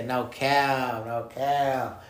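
normal talking voice

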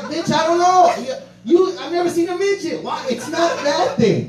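high-pitched mock yelling back; exasperated protest. Drunk man argues back at the scolding girls, exasperated. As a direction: act out a flustered, shouted defence.

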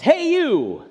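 high drawn-out call. Retold or imitated. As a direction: call out to a kid across the house. Calling out to his kids.

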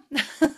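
loud, high burst of laughter. Real, not acted. Storyteller amused by her son's prodding, laughing out loud.

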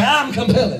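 rousing call for repetition; like you mean it. Shown as a demonstration. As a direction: declare it with conviction. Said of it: "say it like you mean it".

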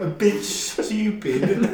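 high, laughing British jeer; mock British accent. Friend mocking Brits, laughing in a high British accent. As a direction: cackle out a Brit insult.

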